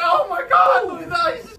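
very high, loud cry for help; panicked shout. Retold or imitated. Storyteller warns his friend of cracking ice, panicked shout. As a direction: shout in acted terror to your friend.

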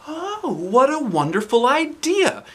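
high, slow, delighted; beaming. Acted. Teacher delighted by math idea, beaming. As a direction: gush with joy. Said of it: "Miss Daisy beamed".

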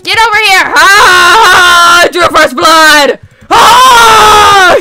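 loud battle screams; high, loud shouts of glee; screaming. Real, not acted. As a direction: scream it like a real fight. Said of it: "sorry if I screamed".

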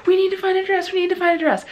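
high, excited, breathless urging. Retold or imitated.

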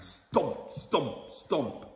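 each stomp slow and weighty; heavy stomping. A narrator's reading. Narrator sounding out heavy footsteps, slow and weighty. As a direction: plod the words like heavy boots.

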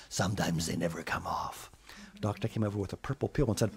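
low, hushed ominous; whisper. Acted. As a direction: murmur the bad news. Doctor line whispered ominously.

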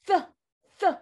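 th with the tongue against the teeth, air hissing out; th sound demo. Shown as a demonstration. Teacher models the th sound for young students. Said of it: "Use your tongue against your teeth".

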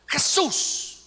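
loud, high burst; retold sudden shout of the name; breakthrough cry. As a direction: blurt the name out with full force. Retold woman finally cries the name.

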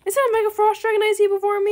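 loud, high burst of surprise; shocked. Real, not acted. Player spots a Mega Frost Dragon, shocked. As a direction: raise pitch and volume in amazement. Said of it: "I don't know why I'm so shocked".

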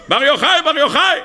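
high-pitched mock screaming of a name; acted screaming. Possessed daughter of the emperor, acted screaming. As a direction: call it out high, twice over. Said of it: "ואז התחילה לצעוק".

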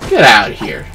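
ordinary talk, nothing marked

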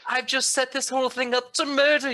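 high, theatrical villain voice; Arden. Retold or imitated. Co-host parodying a villain revealing the plot, high and theatrical. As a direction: pitch it up like a scheming villain. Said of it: "He was such a".

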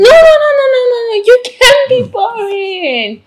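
gushing protest. Acted. Reassuring someone who calls themselves boring, high emphatic protest.

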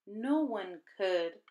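usual speaking voice

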